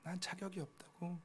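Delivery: 아버지 앞에서 부끄러워 작게 중얼거리듯; the prodigal's plea. Retold or imitated. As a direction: murmur it like a son ashamed before his father. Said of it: "탕자가 아버지 앞에".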